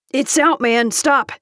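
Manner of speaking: a mild yell to make him quit. A narrator's reading. Bystander telling man the candy is out, yelling. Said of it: "a deep voice yelled".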